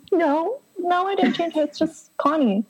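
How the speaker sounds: high, sing-song feigned patience; mock patient reply. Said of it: "I'll be like".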